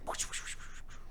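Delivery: very soft, voiceless whoosh noises; whispered whooshes. Retold or imitated. Narrating Cool Cat's jump over the candy, whispered whooshes. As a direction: imitate air rushing by in repeated soft puffs. Imitates sound of the slow jump.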